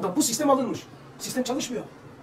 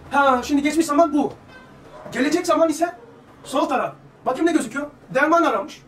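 higher and louder, pressing the point to the crowd; fervent preaching; 6 semitones higher, 6 dB louder than usual. Acted. Preacher urging the congregation, raised fervent voice. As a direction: preach it out with rising fervour. Preaching builds to full force.

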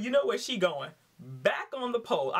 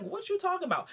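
shocked wife; 12 semitones higher, faster than usual. Retold or imitated. Wife learns husband lied.

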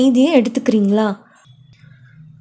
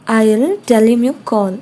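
slow, clear, each word set apart; slow model phrase; much slower than usual. Shown as a demonstration. Teacher modeling a phone phrase for students, slow and clear. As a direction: spell it out slowly for learners. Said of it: "teacher models English phrase".

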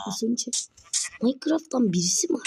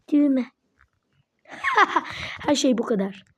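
ordinary delivery

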